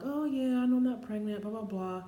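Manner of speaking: high, singsong self-mockery, easing down; feigned nonchalance. Talking to the mirror while waiting, feigned nonchalance. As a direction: play at being breezily unworried.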